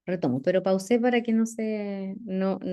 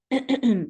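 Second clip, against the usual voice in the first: throat clearing; 5 semitones higher, 3 dB louder than usual. Real, not acted. Clears dry throat mid lecture.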